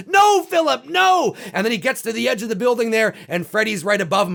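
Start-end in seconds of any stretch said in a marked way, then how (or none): 0.1-1.3 high, loud yell at a sleepwalker; desperate yell at the movie character; shouting at the screen. Retold or imitated. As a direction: yell at him to wake up. Yelling at the screen.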